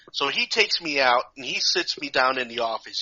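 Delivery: usual speaking voice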